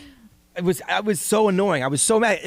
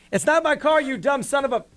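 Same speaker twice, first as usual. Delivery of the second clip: high-pitched retold yell; yelling back; 9 semitones higher than usual. Homeowner yelling back through the doorbell app, indignant retold yell. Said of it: "and yelled back".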